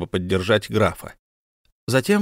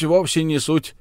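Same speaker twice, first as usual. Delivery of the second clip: a calmer echo of no matter; mild dismissal; 7 semitones higher than usual. Acted. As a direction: let the point drop. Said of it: "замахал руками Беккер".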